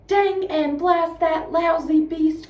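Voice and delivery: slow, loud angry curse; angry cry. Acted. Boggis cursing the fox, a slow loud angry cry. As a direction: spit it out like a furious farmer. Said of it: "cried Boggis".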